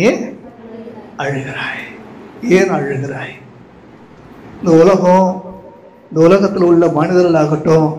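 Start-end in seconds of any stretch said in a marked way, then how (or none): 0.1-3.3 angels asking softly in a hushed whisper; soft whispered why are you weeping; gentle whisper. Acted. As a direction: breathe the question, barely voiced. Angels' question retold.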